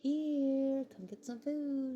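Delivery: high, loud, drawn-out call; imitated sing-song calling to a pet; dad calling strays. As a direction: call a stray animal in for food. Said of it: "my dad's like".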